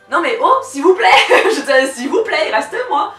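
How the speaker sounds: loud, high pleading call; pleading shout. Retold or imitated. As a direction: call after him, begging. Calling after him pleading.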